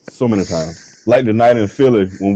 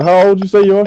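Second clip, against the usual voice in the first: higher, louder pointed question; mock challenging; 10 semitones higher, 4 dB louder, faster than usual. Questioning the birthday girl, higher and louder. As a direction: press her on her age, pitched up.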